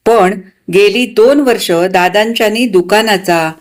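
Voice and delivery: usual speaking voice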